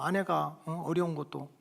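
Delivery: usual speaking voice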